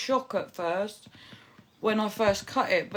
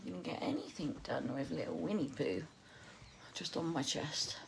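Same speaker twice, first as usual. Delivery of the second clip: hushed whisper; 4 semitones lower, 12 dB quieter than usual. Real, not acted. Baby on chest.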